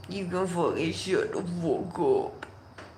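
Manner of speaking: mock echo in a muffled put-on voice. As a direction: repeat it softly with warped, rounded vowels. Repeats the line in odd voice.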